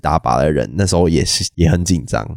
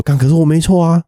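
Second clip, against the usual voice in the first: flustered inner voice; 10 semitones higher, 3 dB louder than usual. Retold or imitated. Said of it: "你听了你会觉得说".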